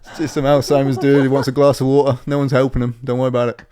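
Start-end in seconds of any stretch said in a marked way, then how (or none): 0.0-1.5 mock shop worker. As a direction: shoo a coworker back to work. Voicing store worker.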